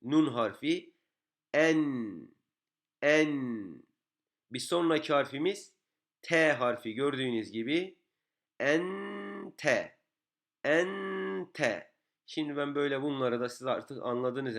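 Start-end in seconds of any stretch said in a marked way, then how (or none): 1.5-3.8 "En" held long on the n; "En" as a drawn-out closed syllable; stopping on the nun. Shown as a demonstration. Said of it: "harfte duraklamamızı sağlıyordu". 8.6-11.9 "Ente" with a long high en, then te; "Ente" recited slowly, first syllable raised and sustained; pausing on the nun. Shown as a demonstration. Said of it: "harfte duraklamamızı sağlıyordu".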